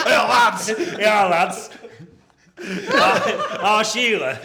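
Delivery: put-on laughing through a loud Jamaican accent; hammy Jamaican accent, cracking up. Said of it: "Was that your Jamaican voice?".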